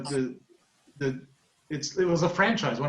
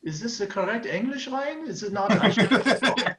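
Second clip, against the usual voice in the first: higher-pitched mock questioning of the grammar; German colleague querying; 7 semitones higher than usual. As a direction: imitate a puzzled non-native colleague checking. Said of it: "Germans at work would say".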